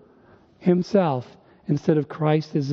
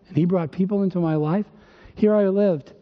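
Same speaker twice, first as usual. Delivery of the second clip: ordinary talk, nothing marked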